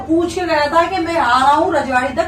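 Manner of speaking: heated self-defence. Real, not acted. Heated quarrel, defending himself. (ऊँची आवाज़ में सफ़ाई देते हुए)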